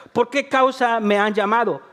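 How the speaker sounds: ordinary talk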